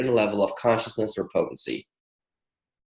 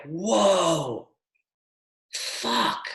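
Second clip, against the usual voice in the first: amazed; 4 semitones higher, 4 dB quieter than usual. Retold or imitated. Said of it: "and I was like".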